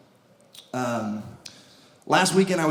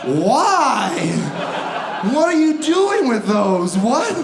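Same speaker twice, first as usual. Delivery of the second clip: alarmed disbelief; 4 semitones higher, slower than usual. Acted. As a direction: shout it in horrified disbelief. Alarmed reaction to the sign.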